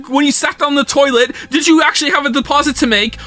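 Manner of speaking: ordinary delivery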